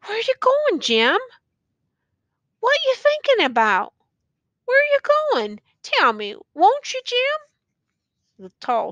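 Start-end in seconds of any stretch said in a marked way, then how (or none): 0.1-7.4 high, anxious questioning; quavering question. A narrator's reading. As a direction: ask with dread, pitched way up. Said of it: "began quaveringly to question him".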